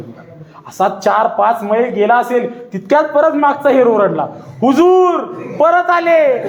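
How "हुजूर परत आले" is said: high, urgent call; alarmed shout. A narrator's reading. The spy shouts to Khan that the Marathas are back. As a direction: shout the news up the line.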